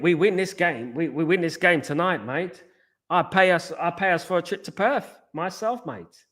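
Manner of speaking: team talk mimicking Ange in a pushed-up Aussie voice; Ange Australian impression. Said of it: "his team talk is gonna be like".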